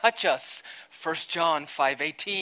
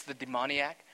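ordinary talk, nothing marked

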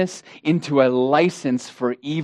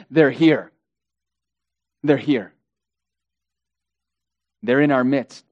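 lower-pitched, grave statement with long pauses; grave announcement; 3 semitones lower than usual. Acted. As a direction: let the danger land, pausing heavily. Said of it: "Jude says".

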